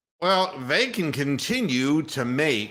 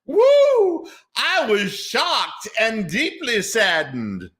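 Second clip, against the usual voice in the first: a high, loud, hammed-up Haslam accent with a whooping start, slow; exaggerated Jimmy Haslam accent, much higher and slower; 10 semitones higher, 5 dB louder, slower than usual. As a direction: send up the Browns owner's accent with a yelp. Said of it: "in their best Jimmy Haslam accent".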